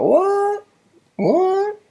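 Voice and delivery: high-pitched, drawn-out incredulous "what"; mock disbelief. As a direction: squeak it in exaggerated disbelief. Mock disbelief at others' claim.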